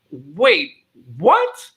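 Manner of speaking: disbelief. Retold or imitated. Costar reacting to Andrea's claim of no sitcom experience, mock disbelief. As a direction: retell it as an incredulous double take.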